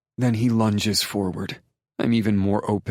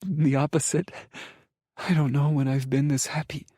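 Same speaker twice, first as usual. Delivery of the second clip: higher, tearfully happy voice; watery giggle; 4 semitones higher than usual. A narrator's reading. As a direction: answer through happy tears, pitched up. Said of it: "my wobbling smile with a watery giggle".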